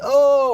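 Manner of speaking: high, loud, drawn-out whoop; imitated excited "oh". Imitating the DJ filming him dancing, excited whoop. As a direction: mimic someone whooping behind a camera. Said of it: "is filming me and going".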